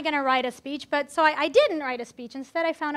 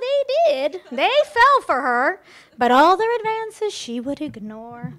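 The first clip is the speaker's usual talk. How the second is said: lilting fairy-tale poem read aloud to the guests; playful wedding-toast fairy tale recital; storybook rhyme reading; 3 semitones higher, 5 dB louder, slower than usual. Acted.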